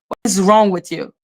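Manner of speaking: ordinary talk, nothing marked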